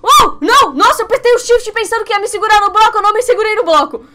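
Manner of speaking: high, loud panicked shrieks as she falls; panicked screaming. Real, not acted. Streamer falling in the game, shrieking loud and high. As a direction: yelp and scream at the slip.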